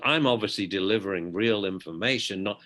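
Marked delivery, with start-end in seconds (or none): none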